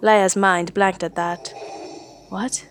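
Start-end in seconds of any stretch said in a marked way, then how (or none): 2.3-2.7 whispered. A narrator's reading. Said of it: "she whispered".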